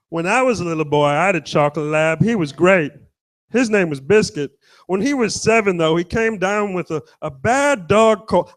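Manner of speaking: a lightly put-on odd voice, reminiscing; rambling stranger. Stranger telling the comic about his childhood dog, lightly voiced and nostalgic. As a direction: tell the childhood dog story like a sentimental stranger. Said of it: "and goes".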